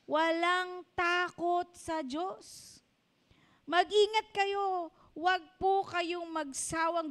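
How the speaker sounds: preacher slows down to caution; preaching emphasis. Real, not acted. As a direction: press the warning on the flock. Preaching warning.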